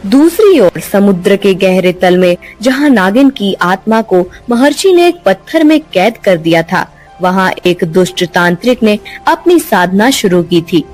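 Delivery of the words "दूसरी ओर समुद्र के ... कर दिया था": plain reading voice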